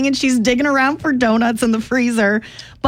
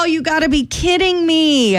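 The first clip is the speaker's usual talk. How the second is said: retold dismay; 6 semitones higher than usual. Retelling own dismay at shop.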